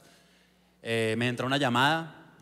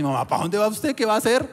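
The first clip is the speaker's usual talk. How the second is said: alarmed mother; 9 semitones higher, 6 dB louder than usual. Retold or imitated. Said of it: "cojo unas tijeras".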